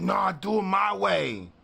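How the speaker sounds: loud, slow mock yell of a pushy guy; mock shout. Pushy rapper mock-shouting at the narrator. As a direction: bark it out like a know-it-all. Retelling a guy's demand.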